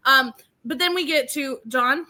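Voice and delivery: ordinary talk, nothing marked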